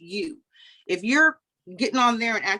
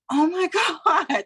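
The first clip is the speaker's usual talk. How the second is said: high, laughing mock shock; laughing dismay; 9 semitones higher, 4 dB louder than usual. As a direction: break into laughter mid-exclamation. Reacting to daughter's blunt verdict.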